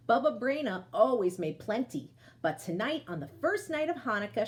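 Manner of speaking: ordinary delivery, nothing marked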